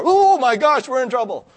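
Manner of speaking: high, loud mock panic; panicked teens. Caught teenagers panicking, mock high squeal.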